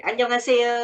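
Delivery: mock-Korean sing-out; playful Korean imitation. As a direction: put on a Korean drama voice. Playfully mimics Korean greetings.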